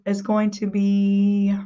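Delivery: usual speaking voice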